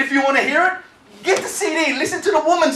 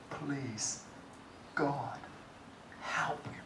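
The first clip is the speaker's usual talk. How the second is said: softer, breathy plea to God; barely voiced plea; whispered prayer; 7 semitones lower, 23 dB quieter, much slower than usual. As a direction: whisper a last desperate prayer. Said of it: "All I could say was".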